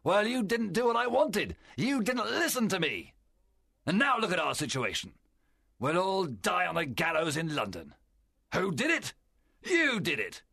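high-pitched indignant outburst; very angry, strong. A narrator's reading. Silver turns the mutineers' blame on them, very angry. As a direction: throw the blame straight back at them. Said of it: "Silver was very angry, and his voice was strong".

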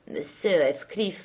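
usual speaking voice

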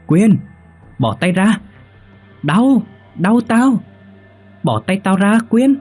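high-pitched pained yelps; cries of pain. Acted. Roommate's head squeezed by sleeping friend, cries of pain. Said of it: "Tiếng kêu đau của Trang".

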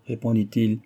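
ordinary delivery, nothing marked

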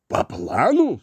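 exclaiming. Acted. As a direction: cry it out in disbelief. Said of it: "вскричал Лин".

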